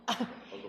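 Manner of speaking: soft unvoiced chuckle; a quick airy laugh; amused breath of laughter; breathy laugh. Real, not acted. Chair laughs at missing head shake.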